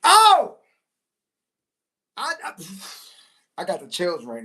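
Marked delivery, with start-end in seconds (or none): 0.0-0.6 excited shout. Real, not acted. Hyped by own words.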